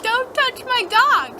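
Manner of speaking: high, slow, drawn-out warning; acted indignant warning. Dreamer warns woman off her dog, high and indignant.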